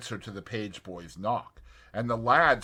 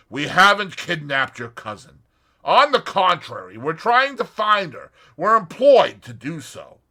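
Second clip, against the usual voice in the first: a touch louder and quicker, earnest; hurried reassurance; 8 semitones higher, 12 dB louder than usual. A narrator's reading. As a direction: hasten to set him straight. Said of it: "Tommy hastened to explain".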